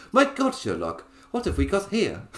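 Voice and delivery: high, posh English voice full of delight; a giggly, high posh English lady's voice; Enola Holmes posh voice. Retold or imitated. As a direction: chirp it like a plucky Victorian girl. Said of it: "That's the voice in my head".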